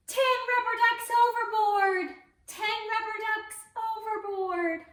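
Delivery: high, loud call to repeat; captain's shout for the kids. Shown as a demonstration. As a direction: shout it out for the children to copy. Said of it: "Can you say that at home".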